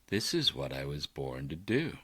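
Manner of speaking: lightly voiced happy dog; dog's inner voice. Voicing the dog's thoughts.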